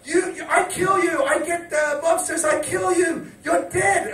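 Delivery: hammed-up high voice of an enraged woman; imitated furious girl. Japanese girl threatening his drunk friend, imitated fury.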